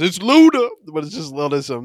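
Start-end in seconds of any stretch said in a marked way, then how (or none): 0.0-0.7 high-pitched hyped-up intro shout-out; mock rap ad-lib, high and punchy. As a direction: imitate a rapper announcing himself, pitched way up. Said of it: "Every time [name], you know, goes".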